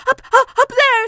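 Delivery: high, loud stutter; breathless panic. Acted. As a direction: puff it out urgently. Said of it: "breathing so hard that he could hardly speak".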